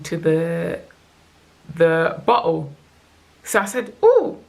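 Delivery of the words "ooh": high, louder retold ooh; sudden high note of discovery; delighted surprise. Retelling noticing baby latch onto bottle.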